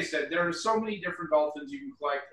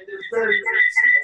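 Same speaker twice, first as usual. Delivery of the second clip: big hall echo; 6 dB louder, much faster than usual. Real, not acted. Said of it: "Sorry about the reverb".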